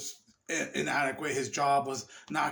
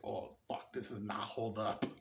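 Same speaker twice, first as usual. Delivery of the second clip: low, hushed disappointment; quiet letdown; 7 semitones lower, 11 dB quieter than usual. Acted. Viewer rewatching an old favorite, quietly let down. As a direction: mutter it to yourself, let down.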